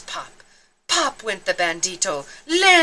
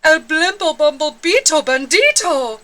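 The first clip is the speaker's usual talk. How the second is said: high, loud gasp of a name; loud fearful exclamation; excited crowd cry; 6 semitones higher, 5 dB louder than usual. Acted. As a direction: announce the villain with alarm.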